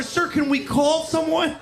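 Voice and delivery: high, earnest mock pleading; naive country girl. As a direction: lightly voice her worried plea. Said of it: "she's down there".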